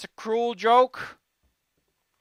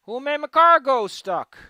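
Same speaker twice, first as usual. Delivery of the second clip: frustrated yell; 6 semitones higher, 10 dB louder than usual. Real, not acted. Frustrated yelling at stuck car.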